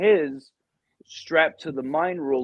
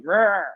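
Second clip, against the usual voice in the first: raised, loud imitated squawk; chicken squawk; 7 semitones higher, 5 dB louder than usual. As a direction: let out an annoyed chicken's cry. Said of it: "And he'd be like".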